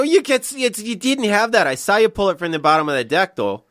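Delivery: put-on high, loud drunken Minnesota card-table protest; mock outrage in a thick Minnesota accent; strong Minnesota accent. Relative calling out card cheating at midnight, strong Minnesota accent. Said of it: "the accent gets so much stronger".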